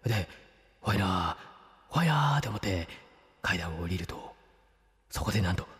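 low, hushed storyteller; hushed creepy ghost story. Acted. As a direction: tell it like a ghost tale in the dark. Spooky ghost story opening.

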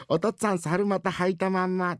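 put-on loud child voice. Son announces father's underpants slip, loudly. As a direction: blurt it out like a tactless kid. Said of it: "小さい声で言え".

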